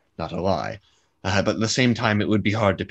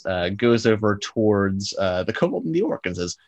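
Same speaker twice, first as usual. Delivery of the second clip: ordinary delivery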